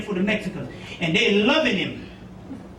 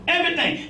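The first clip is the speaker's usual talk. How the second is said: Everything. pitched up high and falling; emphatic everything; 6 semitones higher than usual. Real, not acted. Telling the audience that stress drives it all, emphatic. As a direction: land hard on Everything.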